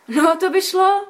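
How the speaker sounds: Květa jubilantly agreeing. A narrator's reading. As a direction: cheer it out gladly. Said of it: "zajásala Květa".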